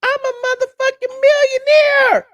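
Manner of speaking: high, loud put-on voice for the boastful millionaire; mock rich man. Vlogger mocking the rich man's boast, loud high put-on voice. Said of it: "money out the bank".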